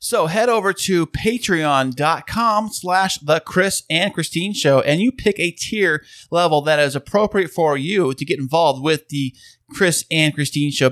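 ordinary talk

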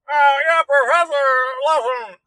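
high, loud character voice; other hillbilly character. Shown as a demonstration. Streamer showing the one voice he can do, high character voice. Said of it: "I can do that one".

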